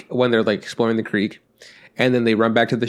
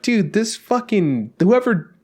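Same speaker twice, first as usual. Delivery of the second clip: high, slow exasperated rant; 8 semitones higher, slower than usual. Retold or imitated. Ranting at the show's director, slow and exasperated. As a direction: drag it out, fed up. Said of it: "And I was like".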